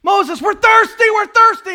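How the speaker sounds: the people shouting their thirst at Moses, high and loud; desperate shout. Retold or imitated. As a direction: yell it at Moses, parched.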